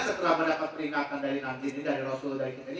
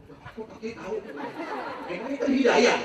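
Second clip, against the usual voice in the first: high-pitched mock astonishment, rising to a loud finish; astonished reaction; 8 semitones higher, 3 dB louder than usual. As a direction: act out an amazed listener. Astonished woman in retold conversation.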